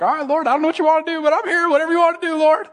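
high-pitched, quick resigned prayer; giving in to the Lord. Retold or imitated. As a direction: offer yourself up in one high rush. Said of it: "the other one kept talking to me. And I'm like".

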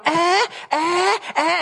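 put-on high whiny baby "eh eh" sounds; imitated baby fussing. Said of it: "she was going".

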